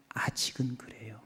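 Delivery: hushed trailing aside; whispered. Real, not acted. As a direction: drop to a whisper.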